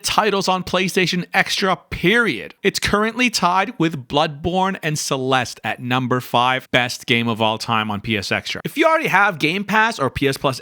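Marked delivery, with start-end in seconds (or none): none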